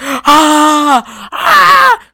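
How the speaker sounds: high, loud mock screams; kid screaming. Kid wakes to cows in his room, screaming. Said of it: "the kid screams".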